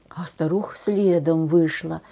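ordinary delivery, nothing marked

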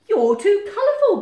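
high, very loud disapproval; scandalised storybook scolding; tutting Badger. A narrator's reading. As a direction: exclaim it in loud outrage. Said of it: "Badger tutted".

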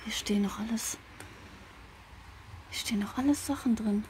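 soft unvoiced whisper; whisper, stay unheard; nervous night vlog whisper. Real, not acted.

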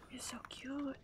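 hushed, slow whisper over something cute; gushing whisper. Real, not acted. Gamer whispering to a cute goat, hushed and slow. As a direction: breathe it out like a secret.

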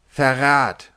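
King Pest roars treason; roaring. Acted. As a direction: bellow it like an outraged king. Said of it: "brüllte seine Majestät König Pest".

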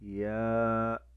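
slow, stretched ya sound; drawn-out letter name. Shown as a demonstration. Teacher sounding out the letter for children, slow and stretched. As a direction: say الياء slowly, holding the long a. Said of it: "اسمه حرف الياء".

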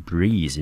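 "breathe" with a long ee, not eh; "breathe" pronounced with ee as in "see"; breathe, ee sound. Shown as a demonstration. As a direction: say the verb "breathe" with its ee vowel. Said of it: "念成e的音".

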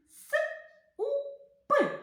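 each sound said apart, high and clear; separate sounds. Shown as a demonstration. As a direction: sound out Р, А, К one by one. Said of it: "Поєднайте разом".

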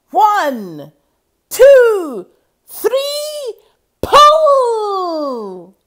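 rally everyone for one huge heave; building count to a shout. Acted.

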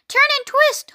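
very high goose-like call; honking goose. A narrator's reading. Goose shouting advice to Wilbur, honking. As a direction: honk it out way up high. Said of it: "honked the goose".

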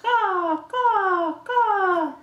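high, falling caws; crow caw. Acted. Parent showing what the crow says, high cawing. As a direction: cry out three caws like a crow. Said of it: "What does the crow say?".

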